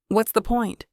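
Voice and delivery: usual speaking voice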